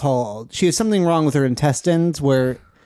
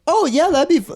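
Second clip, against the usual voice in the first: mock excited mom; 11 semitones higher than usual. Mimicking mom's excited reply.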